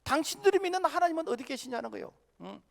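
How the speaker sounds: high-pitched, insistent challenge; challenging doubter. Acted. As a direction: press the question as an acted-out skeptic. Acting out scoffers challenging believers' God. (높은 어조로 따져 묻는 말투)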